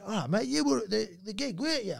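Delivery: high-pitched imitation of a chatty punter; eager bloke. Bloke recognising the comedian from the gig, eager and high-pitched. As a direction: voice a keen fan up high. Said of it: "he went".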